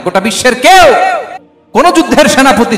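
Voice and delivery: high, loud preaching cry; impassioned shout. Real, not acted. Preacher challenging any commander in the world, impassioned shout.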